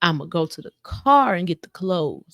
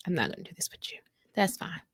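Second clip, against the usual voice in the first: dropping the argument; 10 dB quieter, faster than usual. Retold or imitated. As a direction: say it hushed, half whispered, refusing to argue. Acted giving up on the argument.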